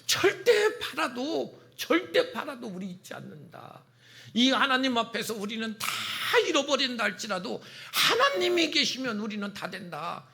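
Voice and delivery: high-pitched prophet crying out to the people; throat-bursting proclamation. Acted. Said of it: "목이 터져라 이야기하면서". (목이 터져라 외치는 선포)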